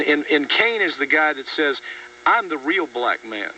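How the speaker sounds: normal talking voice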